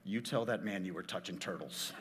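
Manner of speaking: low, soft stern warning; wife's low parting order. Retold or imitated. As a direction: warn him quietly and firmly. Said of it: "Last thing she says".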